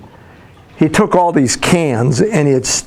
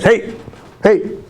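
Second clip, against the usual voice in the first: higher, short calls for attention; mock eager call; 6 semitones higher than usual. Waking up bringing your whole self today, eager. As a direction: playfully call out like a proud kid.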